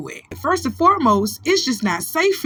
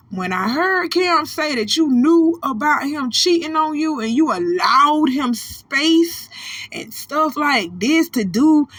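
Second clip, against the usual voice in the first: ordinary delivery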